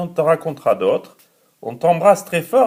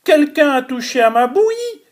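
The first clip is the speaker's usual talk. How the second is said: higher, quick mama bear voice; middle bear's voice; 9 semitones higher, faster than usual. Acted. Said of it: "de sa voix moyenne".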